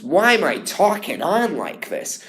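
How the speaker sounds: "talking" sung so it sounds like taking; imitating other Billies. Student copying how other Billies sing the line. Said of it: "this is what a lot of the Billies do".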